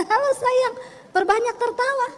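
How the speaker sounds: high, loving hello to the wife; sweet greeting. Retold or imitated. (menyapa istri dengan mesra)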